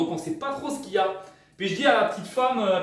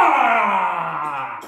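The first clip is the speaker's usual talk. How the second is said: loud outraged cry; 2 semitones lower, 6 dB louder than usual. Real, not acted.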